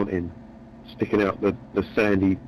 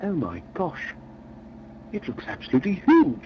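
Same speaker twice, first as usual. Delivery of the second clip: high, awestruck gasp; mildly hammed-up amazement; awed mate; 8 semitones higher than usual. [name] gawping at a woman.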